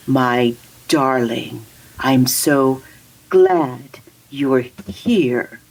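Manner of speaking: very slow, low mock pastor voice. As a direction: imitate him, dragging out each word with odd gaps. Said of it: "why does he talk like this".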